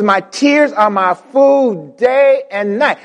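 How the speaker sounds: slow solemn reading. Acted.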